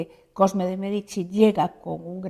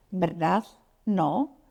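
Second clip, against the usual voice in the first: "¿Verdad?" and "¿No?" each rising at the end as a question; question tune; much slower than usual. Shown as a demonstration. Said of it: "con entonación de pregunta".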